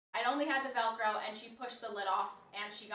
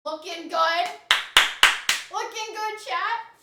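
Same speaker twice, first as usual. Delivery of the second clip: upbeat hype; 7 semitones higher, 12 dB louder, slower than usual. Real, not acted. Hyping chat.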